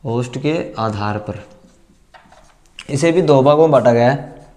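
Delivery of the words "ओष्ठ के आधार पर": slow and softer, lower, for the students to copy; slow quiet dictation. Shown as a demonstration. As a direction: say it slowly and softly to write down. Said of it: "चलिए अब दूसरा पढ़ते हैं". (धीरे और धीमी आवाज़ में लिखवाते हुए)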